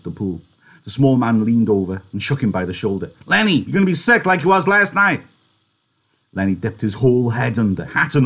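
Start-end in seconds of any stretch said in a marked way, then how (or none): 3.3-5.2 high, raised voice of exasperated warning; loud scolding. Acted. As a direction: scold him loudly, pitch up. George scolds Lenny over the water.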